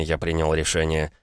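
usual speaking voice

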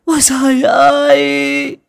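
very high, loud, drawn-out keening; shrieking lament. Acted. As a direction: howl your grief up to the heavens. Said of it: "quỳ xuống lại chu chéo". (chu chéo kêu trời, gào khóc ai oán)

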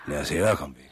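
loud, urgent push to leave. Acted. Jack urges Bill to leave as triffids come, loud urgent call. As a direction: press your friend to get out now.

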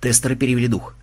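ordinary delivery, nothing marked

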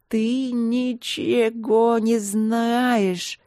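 drawn-out mumble, very slow; slow lowing mumble; mumbled. A narrator's reading. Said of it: "промычала Винин".

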